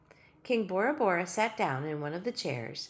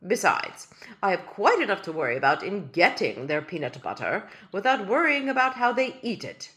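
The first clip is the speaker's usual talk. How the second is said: loud, stately royal voice; a small king complaining with dignity; pompous tiny king; 7 dB louder than usual. Acted. Tiny king waving off Ellie's complaint, loud and pompous. King's voice held.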